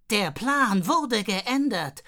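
slow, loud, icy secret agent; ice-cold agent voice. Acted. Fanny answers Xiao Meng in an icy agent voice. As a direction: lay it down cold as a spy. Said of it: "mit einer eiskalten Agentinnenstimme".

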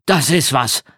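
impressed, emphatic exclamation; lively outburst of admiration; exclaiming. A narrator's reading. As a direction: call it out, mildly amazed. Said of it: "rief er aus".

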